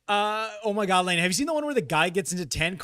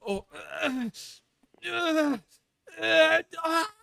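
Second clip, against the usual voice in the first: high strained cries of pain; mock agonised groans pitched way up; imitated screaming; 11 semitones higher, slower than usual. Mimicking the scalded girlfriend, high pained screams. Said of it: "Just the screaming, dude".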